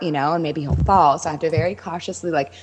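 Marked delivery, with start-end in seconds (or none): none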